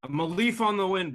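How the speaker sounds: high, loud recited quote; movie quote. Acted. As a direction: belt out the line like a pilot. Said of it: "It is Serenity".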